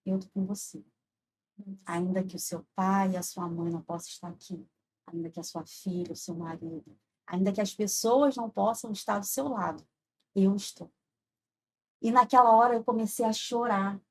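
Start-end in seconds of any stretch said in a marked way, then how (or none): 0.1-10.9 soft, low, measured voice of comfort; retold reassuring God. God reassuring a woman alone in crisis, hushed and tender. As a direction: speak gently as God consoling someone alone. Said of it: "eu só ouvia o Senhor falar assim".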